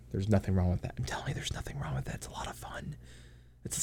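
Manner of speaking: confiding whisper. Real, not acted. Telling chat it's fine, hushed whisper.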